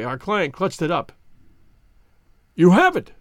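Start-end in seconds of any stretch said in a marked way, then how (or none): 2.6-3.1 loud breathless cry of relief; gasped joy. Acted. Banker sees the gems, gasping joy. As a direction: gasp it out in joy. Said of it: "he gasped".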